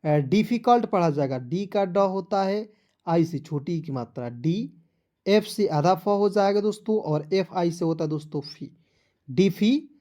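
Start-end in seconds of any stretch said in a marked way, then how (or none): none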